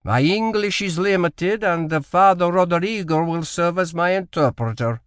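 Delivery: put-on halting Portuguese accent, apologetic; Portuguese governor excusing his English; strong foreign accent. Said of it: "in a strong accent".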